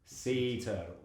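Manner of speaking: low, slow, soft character voice. Retold or imitated. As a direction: drop down and draw it out quietly. Imitating [name] character's reply.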